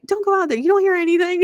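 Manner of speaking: mock husband. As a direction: parody him scolding, squeaky. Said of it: "He was like".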